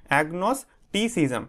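"agnosticism" slowly split, agnos - ticism; broken into syllables. Shown as a demonstration. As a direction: model "agnosticism" in separate chunks. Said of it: "এইভাবে ভেঙে ভেঙে উচ্চারণগুলো করবে". ("agnosticism" ভেঙে ভেঙে ধীরে উচ্চারণ)

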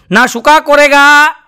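put-on slow, drawn-out Nepali-accented shayari; mock Nepali accent, stretched out; Nepali guard's mangled Hindi. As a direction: recite it slowly like the Nepali watchman. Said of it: "એણે કીધું".

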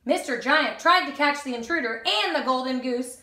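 ordinary delivery, nothing marked

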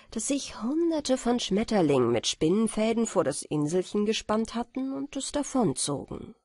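plain reading voice

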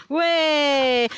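high loud cheer for the dog; praising a dog. Real, not acted. Dog comes back, praising.

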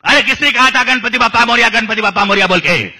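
high comic shout. Shown as a demonstration. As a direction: jump up into a frantic yell. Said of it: "from here you will certainly jump".

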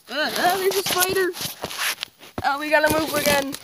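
high startled yelp, fast; screaming at a spider. Real, not acted. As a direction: shriek at the spider and flee. Spotting a spider in his spot.

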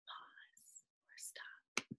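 very soft, slow whisper; hushed, drawn-out cue; whispered reminder. Shown as a demonstration. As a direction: whisper the prompt slowly to the pupils. Soft prompt at each period.

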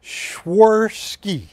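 Swarovski, very slow, stress on ROV; corrected pronunciation. Shown as a demonstration. Retrying Swarovski for Ashley, slow and careful. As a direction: drag out Swarovski syllable by syllable. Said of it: "That's better than what you said".

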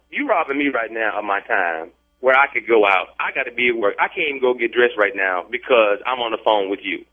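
usual speaking voice

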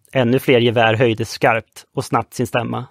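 usual speaking voice

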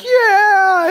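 high, loud joyful shout; triumphant whoop. Retold or imitated. Pastor surfacing after facing his fear, high loud whoop. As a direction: burst up out of the water cheering.

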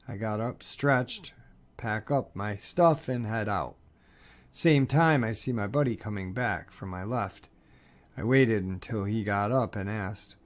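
ordinary delivery, nothing marked

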